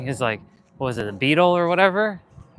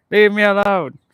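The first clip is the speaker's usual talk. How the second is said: higher, louder, laughing plea; laughing shoo; 6 semitones higher, 6 dB louder than usual. Real, not acted. Vlogger repeating her plea to the bugs, laughing.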